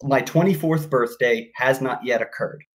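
ordinary talk, nothing marked

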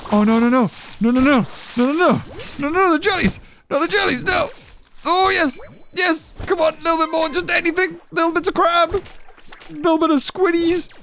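frantic high yelling; yelling at a horse race. Real, not acted. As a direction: yell like a frantic race fan. Frantic cheering on in game.

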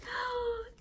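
sharp high gasp of delight. Real, not acted. Vlogger gasping at her gingerbread pieces, delighted. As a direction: gasp at how cute they are.

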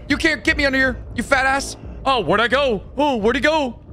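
high, cocky taunt from under cover. Real, not acted. Gamer mocking the monster from hiding. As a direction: rub it in, safe under the table.

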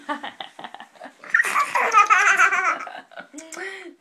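big burst of real laughter; loud laugh. Real, not acted. Mother laughing at baby.

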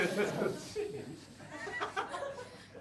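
breathy silent laugh; suppressed giggle; stifled laugh. Real, not acted.

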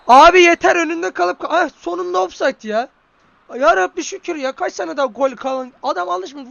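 high, loud cry of fed-up relief; exasperated shout. Real, not acted. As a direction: burst out at the screen, then thank God. Exasperated outburst, then relief.

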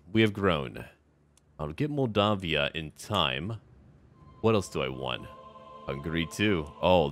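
normal talking voice

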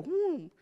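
high, hooted sound effect; mock divine boom. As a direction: hoot the sound, a little smaller. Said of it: "He won't literally go".

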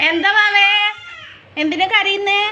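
mother calling out to her crying little one, high and loud. Real, not acted. Mother calls to crying baby.

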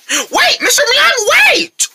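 high-pitched cry to stop him; urgent plea. Acted. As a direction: call him back in a hurry. Neighbor walks off, kids call out.

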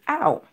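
aou high, gliding from a to u; diphthong demonstration. Shown as a demonstration. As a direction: model A U as aou. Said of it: "A U sa, se aou". (aou ak vwa wo)

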